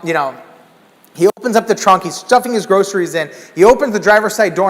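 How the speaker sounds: normal talking voice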